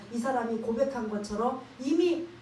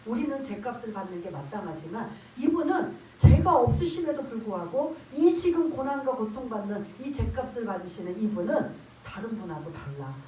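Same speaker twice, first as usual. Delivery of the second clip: ordinary talk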